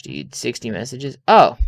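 ordinary delivery

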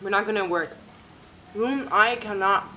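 speaking between bites; talking while chewing. Real, not acted. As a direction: mouth partly full. Seen 2: Bites forkful at 3.6, chews while talking; shrimp itself unclear.

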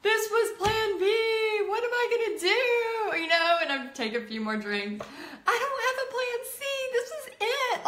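crying out at the bar that there's no plan C; tipsy despair. Retold or imitated. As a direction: wail it like everything's lost. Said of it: "because I'm like".

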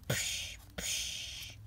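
whispered punch sound effects. Pretend.

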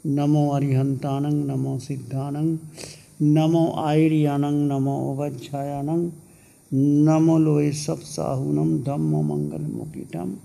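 measured mantra chanting in short, even phrases; prayer recitation. Real, not acted. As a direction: recite a Jain prayer on a steady tone. Recites Jain prayer after meditation. (लयबद्ध मंत्र पाठ)

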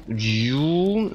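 drawn-out "yuuum" sliding upward; rising whirr made with the voice; imitated recharge sound. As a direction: mimic a shield powering up. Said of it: "ese sonido".

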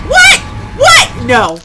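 ordinary talk, nothing marked

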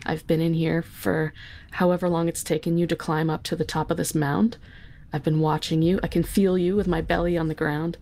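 ordinary talk

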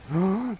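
quick rising gasp; alarmed gasp. Real, not acted. Player spots danger in the game, alarmed gasp. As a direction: suck in air in alarm.

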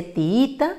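tiita, very slow, stress on the second i; very slow demo. Shown as a demonstration. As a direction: draw out tiita, leaning on the middle.

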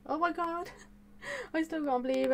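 high-pitched, breaking into a laugh; real giddy squeal with laughter; giddy disbelief. Real, not acted. YouTuber thanking everyone who helped, giddy disbelief.

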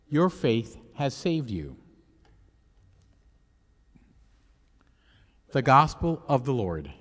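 solemn, measured liturgical reading; Gospel reading. Acted. Priest proclaims the Gospel.

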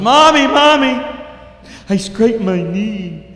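put-on high, loud little boy calling mom; crying child. Little boy running to mom with scraped knee, high and loud. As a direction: wail like a hurt four-year-old. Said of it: "he says".

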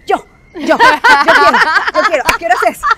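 high, loud, laughing "me, me!"; eager volunteering. Retold or imitated. Mom volunteering in the school chat, bursting with laughing eagerness. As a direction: jump at the chance like a keen pupil.

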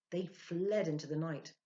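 ordinary delivery, nothing marked

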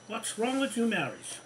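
normal talking voice

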